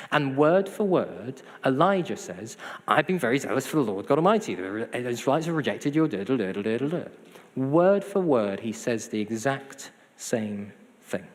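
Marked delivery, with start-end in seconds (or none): 2.9-7.0 fast rattled-off quote trailing into da-da-da; rote recitation. Retold or imitated. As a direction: rattle it off like a memorised speech.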